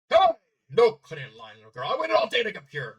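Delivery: loud, indignant shout; angry yell. Acted. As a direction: yell at someone cutting in line. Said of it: "the man yelled".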